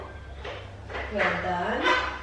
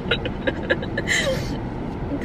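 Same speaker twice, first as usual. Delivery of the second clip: high unvoiced laugh through the nose; breathy laugh; 13 semitones higher, 6 dB quieter than usual. Real, not acted. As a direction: laugh softly, half to yourself. Mom laughs at the crying boy.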